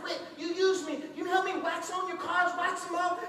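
high, indignant student's complaint; mad. Acted. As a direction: complain like a used, angry kid. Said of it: "And he's mad".

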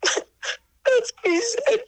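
high, drawn-out tearful voice; real tearful catch, pitched high; choked up. Real, not acted. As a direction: say it slowly, close to crying. Overcome recalling Frank's promise to her.

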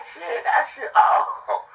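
high-pitched re-enacted exclamation; high, exclaiming self-quote; acted-out reaction. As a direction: play back your own wowed reaction, pitched high. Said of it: "I just be like".

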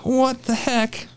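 can't believe the ruler reading; stunned disbelief. Retold or imitated. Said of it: "I was like".

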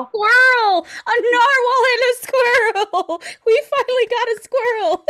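high, loud squeal of joy; excited delight. Real, not acted. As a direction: squeal through laughter, thrilled. Delighted at narwhal and squirrel.

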